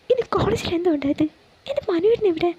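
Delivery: put-on high, anxious young woman denying it; pleading Priya. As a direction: plead innocence, high and worried. (ഉയർന്ന, വേവലാതിയോടെയുള്ള നിഷേധം)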